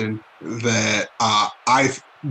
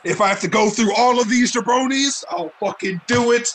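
angry; 8 semitones higher, faster than usual. Acted. Wrestler cutting an angry promo.

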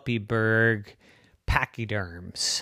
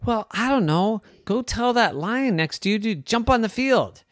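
mouse voice; 10 semitones higher than usual. Acted. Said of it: "Roy answers iguana".

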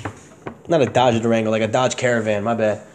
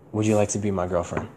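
low, much softer, tender ask; tender soft question; 6 semitones lower, 8 dB quieter than usual. Retold or imitated. Asking her to be his girlfriend at the dock, soft and tender. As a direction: ask it gently, almost hushed.

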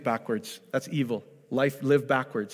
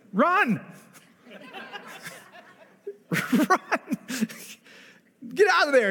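high mock-alarmed cry, laughing; preacher jokingly urging people to flee, higher and laughing; laughing urgent warning; 13 semitones higher than usual. Real, not acted. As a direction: shout it like a playful warning. Joking warning about Bible studies.

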